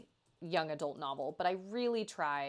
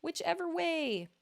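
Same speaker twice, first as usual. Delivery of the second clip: carefree shrug; 11 semitones higher, slower than usual. Retold or imitated. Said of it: "I'm just kinda like".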